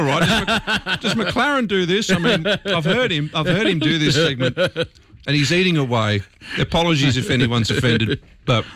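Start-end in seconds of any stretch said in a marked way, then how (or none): 0.2-6.2 laughing. Real, not acted. Laughing at himself eating on air.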